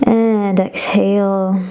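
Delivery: long drawn-out and exhale; slow exhale cue. Shown as a demonstration. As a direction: draw it out with the breath. Breath cue in yoga class.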